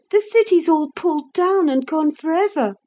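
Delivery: put-on high, pleading child's cry; desperate. A narrator's reading. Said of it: "said Philip desperately".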